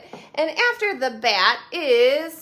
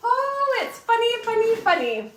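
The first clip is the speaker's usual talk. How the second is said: excited; 10 semitones higher, 4 dB louder than usual. Real, not acted. Excited about silly story.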